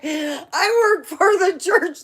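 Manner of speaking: high, slow, loud mock-pious whine; mocking Karen. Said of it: "it was like".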